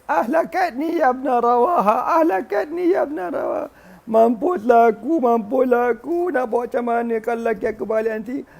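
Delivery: high, hammed-up wailing lament; mock howling cry. Wife fears her husband's return, mock howling cry. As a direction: wail as if doomed. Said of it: "Dia pun menangis melulung".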